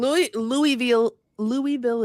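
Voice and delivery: "Louisville" as LOO-ee-vil; wrong pronunciation. Shown as a demonstration. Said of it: "is wrong".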